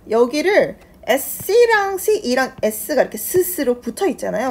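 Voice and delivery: ordinary talk, nothing marked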